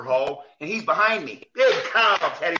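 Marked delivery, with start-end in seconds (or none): 1.5-2.6 high, loud mocking jab; loud sarcastic needling. Coach taunts ref from behind.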